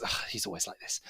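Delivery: soft exasperated sigh; exasperated woman. Acted. Woman complains about busy smith to guests, exasperated. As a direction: sigh it out under the breath. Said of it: "And she goes".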